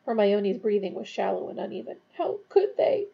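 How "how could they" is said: high-pitched, distressed disbelief; choked up. A narrator's reading. Hermione reacts to the execution, choked with distress. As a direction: read it as upset, a touch choked. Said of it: "she choked".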